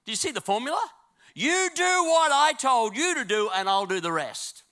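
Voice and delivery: high, loud preacher exhorting the crowd; full-force preaching. Real, not acted.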